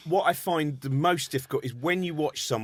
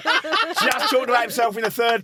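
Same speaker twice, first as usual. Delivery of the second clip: laughing loudly through the words; 8 semitones higher, 5 dB louder than usual. Real, not acted. Laughing at third person.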